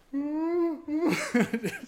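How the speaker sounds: very high, long "ooh"; mock terrified whimper. Villager facing a raving stranger, comic imitation of terrified wailing. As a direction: imitate a frightened man's wordless wail. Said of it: "total terror".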